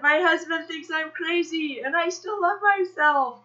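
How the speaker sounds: high, loud, gleeful proclamation; bold public declaration. Acted. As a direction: announce it proudly to the whole mall. Practicing declarations aloud.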